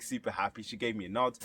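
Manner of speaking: usual speaking voice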